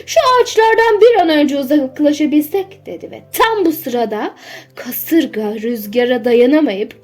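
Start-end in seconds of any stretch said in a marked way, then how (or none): 0.1-2.9 anxious, raised and louder voice; worried. A narrator's reading. Owner in a stormy forest wants away from the trees, worried. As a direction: urge it with higher-pitched worry. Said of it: "Bay Gordon endişeyle".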